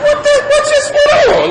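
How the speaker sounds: very high comic panic; nervous bewilderment. Retold or imitated. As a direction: squeal it in mock alarm. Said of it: "I'm getting nervous now".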